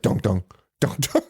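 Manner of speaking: low thudding dunk sounds like a heartbeat; imitated heartbeat. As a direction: thump it out like an ultrasound heartbeat. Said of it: "you hear the baby's heartbeat".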